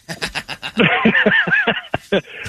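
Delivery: a real, delighted high laugh. Real, not acted. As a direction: burst of high-pitched laughter. Host laughs at Brett's joke.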